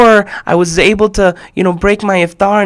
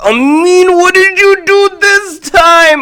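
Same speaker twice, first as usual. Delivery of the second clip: much higher, slow mock-scolding father; exasperated dad; 12 semitones higher, 4 dB louder, slower than usual. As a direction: play the dad sighing at his troublesome son. Dad's look voiced by narrator.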